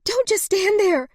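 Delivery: high, loud urgent order; frantic shout. A narrator's reading. Woman shouting at Henry to act, high and loud. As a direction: shout him into action. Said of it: "she shouted".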